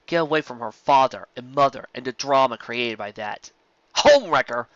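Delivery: ordinary delivery, nothing marked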